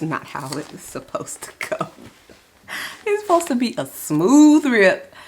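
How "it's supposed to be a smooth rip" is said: loud high annoyed outburst; half-joking loud gripe; frustrated exclamation. Real, not acted. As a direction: complain loudly at the torn envelope. Envelope tearing badly.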